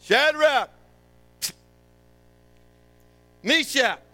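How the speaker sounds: high, loud calls across the crowd; a servant hollering names across the plain; calling out. Acted.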